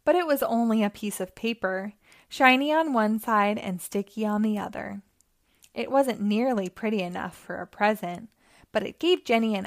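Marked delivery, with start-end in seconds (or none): none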